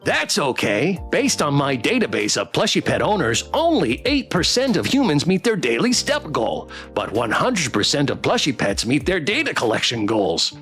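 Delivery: sing-song robot pet reciting facts; bouncy swooping pitch, rising to high excitement; eager plush companion voice; chipper toy pet. Acted.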